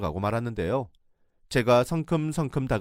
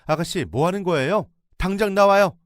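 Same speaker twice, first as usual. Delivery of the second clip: yelling, much higher and louder, building; shouting; 9 semitones higher, 7 dB louder than usual. Retold or imitated. Catching sister-in-law doing laundry at night, yelling. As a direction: storm in and order her out. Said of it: "제가 소리를 질렀고".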